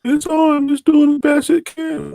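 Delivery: high voice quoting his wife; wife's voice. Retold or imitated. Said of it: "So I told my wife".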